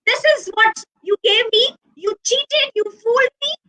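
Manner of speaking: high-pitched accusing shout at a friend; betrayed rabbit shouting at his friend, high and accusing; angry shout. Acted. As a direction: yell that you were cheated. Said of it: "he shouts at Matru".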